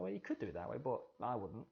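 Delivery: grudging permission with reservations; doubtful hedging. Shown as a demonstration. Teacher voicing the hint behind italics, hedging doubtfully. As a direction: say it as a doubtful aside. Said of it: "it creates that uncertainty".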